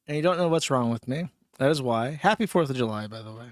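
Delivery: ordinary delivery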